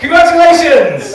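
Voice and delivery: high, loud mock congratulations; over-excited cheer. Real, not acted. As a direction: gush it brightly. Mock cheer to audience member.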